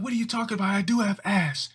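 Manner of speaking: high, offended mock girl; a girl fires back at the boys; indignant girl.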